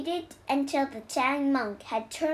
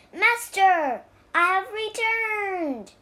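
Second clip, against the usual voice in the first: higher-pitched call to the master; 6 semitones higher, 4 dB louder, slower than usual. A narrator's reading. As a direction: announce your return, calling out. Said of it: "he called".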